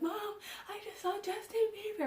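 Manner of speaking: high, squealed retelling of panicked excitement; freaking-out fan. Retold or imitated. Daughter running back to tell mom, mock frantic excitement. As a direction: act out a high-pitched fangirl freak-out. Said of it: "I'm, like, freaking out".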